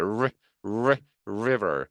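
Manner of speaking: "리, 리, river" each begun with an English R; R-sound drill. Shown as a demonstration. Teacher drilling the R sound, "리, 리, river" with clear R. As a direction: isolate the R syllable, then say "river". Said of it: "알 발음이죠".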